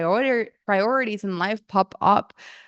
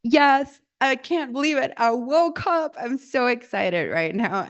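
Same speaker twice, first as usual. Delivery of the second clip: raised, exuberant morning cheer; overjoyed; 3 semitones higher than usual. Retold or imitated. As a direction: wake up thrilled like an eager dog. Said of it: "the exact same feeling as my dogs".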